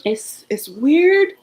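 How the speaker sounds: real tearful thankfulness; overcome, pushing words through tears; holding back tears. Real, not acted. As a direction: choke up until the voice drops out at the end. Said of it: "I don't wanna cry".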